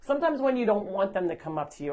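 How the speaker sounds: usual speaking voice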